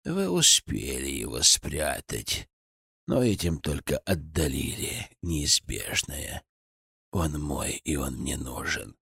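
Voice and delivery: put-on low, slow, hollow old man's voice; hollow cracked voice. A narrator's reading. Old villain Gradov speaks. (глухо и надтреснуто, низко)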